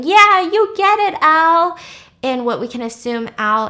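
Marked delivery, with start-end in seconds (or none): 0.0-1.8 loud, high hollering; frat dude yelling. Retold or imitated. Frat dudes cheering on their friend Al, yelling. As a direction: whoop it out like a rowdy frat crowd. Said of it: "a bunch of frat dudes yelling".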